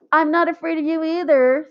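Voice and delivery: high, firm defiant comeback; defiant retort. A narrator's reading. Melinda standing up to Jeff, defiant high retort. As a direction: stand up to him.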